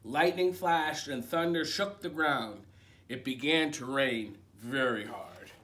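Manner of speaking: lively picture-book read-aloud; reading to children. A narrator's reading. Librarian reading a picture book to children, animated. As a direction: read it like bedtime story time.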